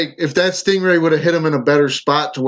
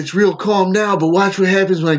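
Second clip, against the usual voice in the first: cartoon Australian wildlife host; excited, hammed-up Australian accent; Australian impression; 3 semitones higher than usual. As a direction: parody an Australian croc wrangler mid-stunt. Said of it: "when they did it on South Park".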